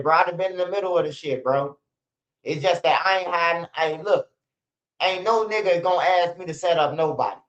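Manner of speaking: usual speaking voice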